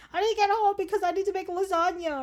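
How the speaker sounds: high-pitched, hammed-up victim's plea; mock screaming. Kidnap victim insisting on getting home, mock screaming. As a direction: plead up high like a pretend captive. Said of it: "screaming happening from you".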